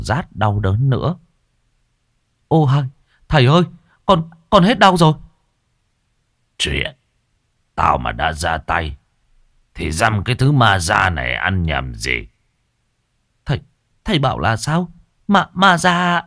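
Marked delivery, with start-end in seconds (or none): 2.5-5.1 high, louder cry of relief; young man's high relieved cry; relieved exclaim. Acted. Patient relieved pain gone. 6.6-12.3 put-on lower, smug old sorcerer; low boastful sorcerer; old master boasting. 13.4-16.2 rising, higher startled question; high startled stammer. Acted. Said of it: "cùng giật mình".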